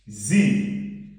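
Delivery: "Z" said as zee, buzzing first sound; German pronunciation. Shown as a demonstration. Teacher showing learners the German pronoun, Z-sound pronunciation. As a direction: voice the opening S of "Z" like an English Z. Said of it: "S is pronounced as Z".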